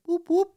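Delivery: high whooping police siren sound; siren imitation.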